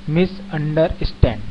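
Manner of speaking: मिसअंडरस्टैंड slowly broken into मिस, अं, डर, स्टैंड; four-syllable split. Shown as a demonstration. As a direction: sound out मिसअंडरस्टैंड piece by piece. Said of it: "उच्चारण चार खंडों में होता है".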